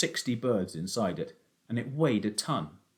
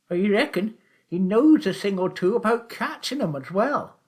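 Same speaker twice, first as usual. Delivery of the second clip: put-on high, loud old countryman's voice; sly old taxi driver; 11 semitones higher, 7 dB louder than usual. A narrator's reading. Old Charlie teasing Willum about the shoot, high and loud. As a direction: play the crafty old poacher, much higher and louder.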